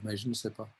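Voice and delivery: normal talking voice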